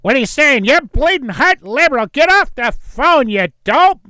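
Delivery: loud, high hammed-up berating; mock angry host. Radio host cutting off a liberal caller, mock angry yell. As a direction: yell it like a ranting radio host, slowing on the put-down.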